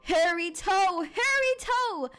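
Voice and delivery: high, wailing spooky call; hollow monster voice. Acted. Monster in the woods demands its toe, eerie wail. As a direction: call it out like a haunting creature. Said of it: "a hollow voice rumbled".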